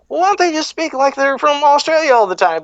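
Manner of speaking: high-pitched mock wondering; imitated perplexed question; imagined puzzled complaint. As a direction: voice a baffled onlooker, pitched well up. Imagined foreign audience wondering about accents.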